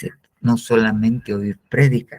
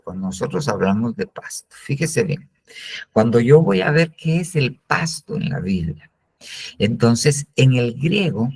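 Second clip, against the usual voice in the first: ordinary talk, nothing marked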